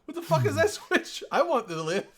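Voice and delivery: very high, loud hammed-up panic with laughter; panicked passenger. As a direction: wail for your life, laughing through it. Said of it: "You're like".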